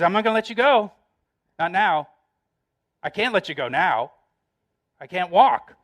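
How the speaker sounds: loud, pleading cry of a desperate man; desperate plea. Acted. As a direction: beg him loudly not to leave. Jacob clinging, desperate plea.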